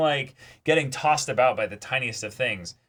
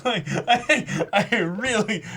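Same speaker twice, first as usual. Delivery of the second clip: laughing; 8 semitones higher, much slower than usual. Real, not acted. Laughing after a blank.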